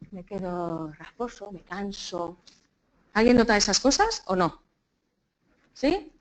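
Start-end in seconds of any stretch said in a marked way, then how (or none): 0.1-2.4 low, soft, slow and worn out; tired, raspy voice. Acted. Teacher voicing someone with voice problems, tired rasp. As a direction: let the voice sag, raspy and tired. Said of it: "hay alguien que dice".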